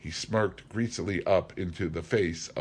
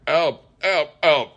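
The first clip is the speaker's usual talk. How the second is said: much higher, loud panicked yelling; terrified cries for help; 10 semitones higher, 10 dB louder than usual. A narrator's reading. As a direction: scream for rescue while being beaten. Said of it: "he cried".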